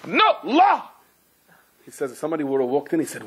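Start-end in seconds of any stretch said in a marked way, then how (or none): 0.1-0.9 much higher, sharp yelled "No, law!"; yelled correction. Retold or imitated. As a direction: snap the correction across the room. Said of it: "the Rosh Hakol yells".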